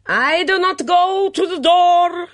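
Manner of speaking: put-on very high, drawn-out accented yell; calling out. A narrator's reading. Mitzi calling from the kitchen, a shrieked refusal. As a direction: yell a refusal to another room. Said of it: "Mitzi called from the kitchen".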